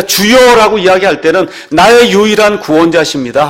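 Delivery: loud, high-pitched sermon delivery; full-force preaching. Acted. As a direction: proclaim it forcefully to the congregation. Preaches a confession of faith.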